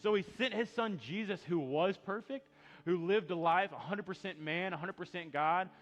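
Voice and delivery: preaching at full force. Acted. Pastor proclaims Jesus' sacrifice, preaching at full force. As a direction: drive the message home.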